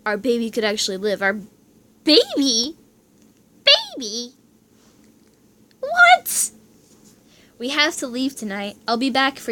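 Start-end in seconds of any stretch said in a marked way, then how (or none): 2.0-4.3 stunned disbelief. Acted. Stunned by the news. 5.8-6.5 a high, loud, stunned what; disbelieving shriek; shocked shout. Acted. Shocked by baby news.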